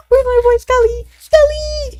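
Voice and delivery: very high, loud call; mock-searching shout in a high voice; playful calling out. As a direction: holler for a missing buddy. Calls out for a friend in chat.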